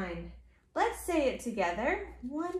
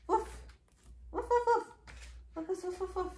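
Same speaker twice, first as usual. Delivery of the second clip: little high woofs in quick runs; short, puffed barking for a toddler; dog woofs; 3 semitones higher, 6 dB quieter, faster than usual. Acted. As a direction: woof like a small dog. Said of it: "A dog".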